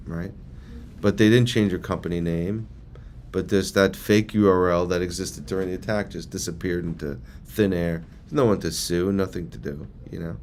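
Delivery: ordinary delivery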